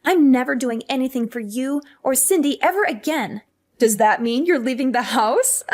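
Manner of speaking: ordinary delivery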